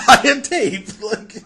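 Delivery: ordinary talk, nothing marked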